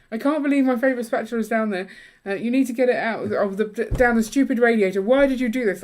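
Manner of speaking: retold angry shout. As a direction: tell him off over the spatula. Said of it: "this is what I shouted at you".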